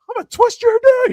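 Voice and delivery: a movie character's over-the-top threat; comic character voice. Retold or imitated. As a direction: ham up a silly menacing line. Quoting Molly Shannon's movie line.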